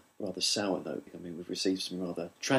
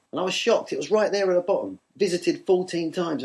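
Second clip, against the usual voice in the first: mock shock; 7 semitones higher than usual. Mock outrage.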